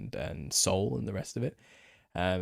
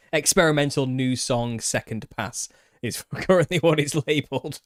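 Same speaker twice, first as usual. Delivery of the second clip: laughing; 5 semitones higher, 8 dB louder than usual. Real, not acted. Laughing while reading the file label.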